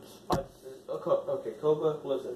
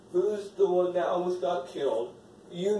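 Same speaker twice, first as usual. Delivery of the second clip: ordinary talk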